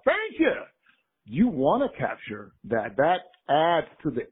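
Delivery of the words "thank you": high-pitched mock audience shout; imitated holler. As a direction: call it out high, like someone in the congregation. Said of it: "and they holler out".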